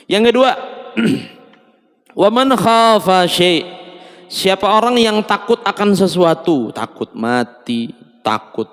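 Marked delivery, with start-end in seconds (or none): 2.2-6.7 higher, slow intoned recitation; recited text. Shown as a demonstration. Preacher intones the hadith text for listeners, slow and lilting. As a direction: intone the Arabic like scripture.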